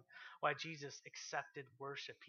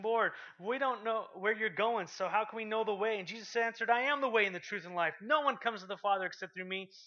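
loud, higher preaching voice; preached scripture dialogue; 4 semitones higher, 7 dB louder than usual. Acted. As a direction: proclaim the verses. Reading Gospel dialogue aloud.

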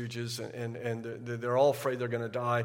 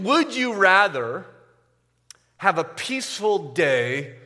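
louder, driving sermon voice; preaching at full force; 4 semitones higher, 9 dB louder than usual. Acted. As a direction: press the point home to the congregation.

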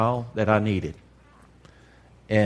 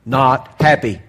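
louder, punched "Not happy"; emphatic; 3 semitones higher, 7 dB louder, slower than usual. Real, not acted. Preacher to congregation, forceful loud emphasis. Preacher stressing his anger.

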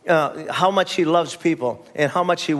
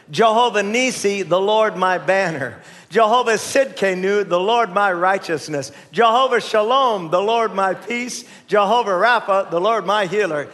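leading group recitation; 4 semitones higher than usual. Real, not acted. Preacher has congregation say God's names together, measured recitation. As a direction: lead the room in saying it together. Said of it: "Let's all say 'em together".

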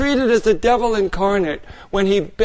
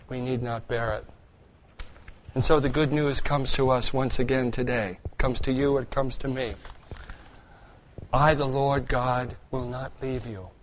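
soft, much quieter preaching, close and tender; hushed sermon; 5 semitones lower, 6 dB quieter than usual. Acted. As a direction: speak it gently, as reassurance to the congregation. Soft, intimate preaching passage.

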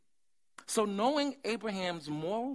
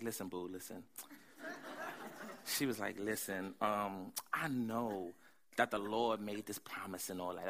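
soft, low coaxing wife; Sarah's voice; 7 semitones lower, 5 dB quieter than usual. Retold or imitated. Wife pulling husband aside, in a lower, softer coaxing voice. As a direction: sweet-talk him low and soft. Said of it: "this is how I think Sarah talks".

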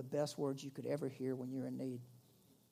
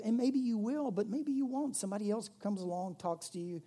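normal talking voice

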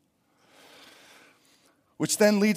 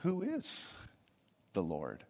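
mock Pharaoh; 8 semitones lower, 10 dB quieter, slower than usual. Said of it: "with these words".

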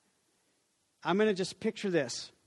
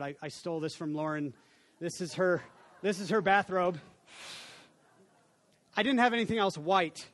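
normal talking voice

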